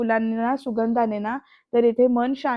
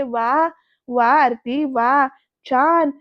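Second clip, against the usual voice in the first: slow, drawn-out mock applause, louder; sarcastic praise; 5 dB louder, much slower than usual. Dhanashri confronting Aarti for praising her sister, slow sarcasm. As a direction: draw out each word with loud sarcasm.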